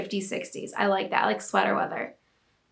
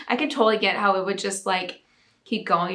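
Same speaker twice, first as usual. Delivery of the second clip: ordinary talk, nothing marked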